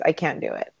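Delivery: usual speaking voice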